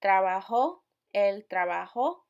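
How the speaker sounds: "Trabajó" punched loud on the last ó, rising; stress on final Ó. Shown as a demonstration. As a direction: hit the accented ó at the end of each "trabajó". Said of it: "putting emphasis on the O".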